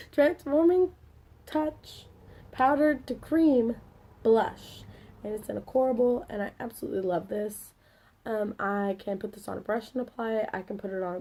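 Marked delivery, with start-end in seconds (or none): 0.1-4.8 slow, deliberate product name, word by word; careful re-reading. Real, not acted. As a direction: read it out carefully in separate chunks. Restarts product name after misreading it.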